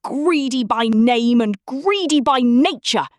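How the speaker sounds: big, booming giant voice; hearty giant's bellow. Acted. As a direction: bellow it like thunder. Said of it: "he bellowed".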